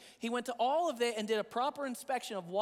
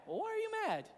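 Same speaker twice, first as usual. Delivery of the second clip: probing question; 11 semitones higher, faster than usual. Retold or imitated. Pastor answers himself, probing.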